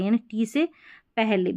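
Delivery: normal talking voice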